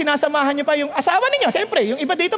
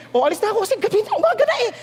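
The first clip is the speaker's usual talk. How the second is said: mock lover's goodbye; 6 semitones higher, much faster than usual. Acted lover's late-night goodbye.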